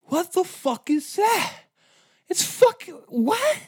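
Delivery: very high, strained disbelief; shocked rider. Retold or imitated. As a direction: shriek it like your eyes can't believe it. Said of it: "You're just like".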